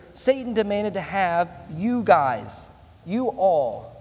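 slow down and hit you guys, then you all; stress on you guys. Shown as a demonstration. Pastor drives home that you means all of you, slow emphatic stress. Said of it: "the point here".

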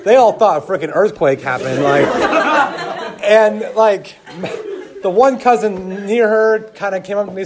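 high and loud, laughing through it; cracking up as it all comes back. Real, not acted. Telling the congregation about the chaos, loud laughter.

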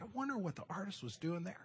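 soft inner musing; a hushed, curious thought; musing to himself. Retold or imitated. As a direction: wonder it quietly under your breath. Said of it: "I'm like".

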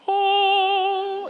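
high, sustained sung "oh"; heavenly gate sound. Retold or imitated. Gate miraculously opens after his prayer, high sung note. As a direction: sing one long high note of mock wonder. Imitates gate swinging open.

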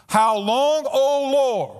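an urgent, awed plea; reverent cry. Acted. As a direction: lift it up as a cry to the Lord. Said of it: "Now note reverence".

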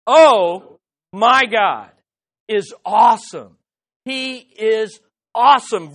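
loud, slow OH, then weighty MY GOD IS AWESOME; slow, loud proclamation stressing OH and AWESOME; emphatic exclamation. Shown as a demonstration. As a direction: lead a crowd in a booming OH, phrase by phrase. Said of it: "So let's do that again".

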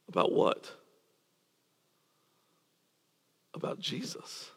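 whispered, breathy aside to the room; hushed question and answer. Acted. Preacher asks and answers hushed.

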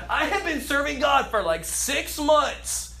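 high, pompous, self-important; playfully mock a vain servant; mock entitled believer. Preacher mocking believers wanting fame, pompous and high. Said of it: "makes your brain think weird things".

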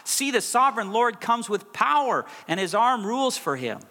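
ringing pulpit proclamation; proclaimed at full force. Acted. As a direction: thunder it out like a prophet.

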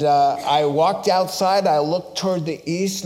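usual speaking voice

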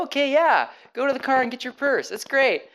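high-pitched, breezy agreement; carefree enthusiasm. Retold or imitated. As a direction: agree happily without a care. Carefree reply to girlfriend at party.

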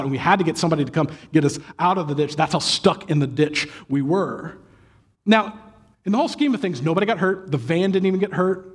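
usual speaking voice

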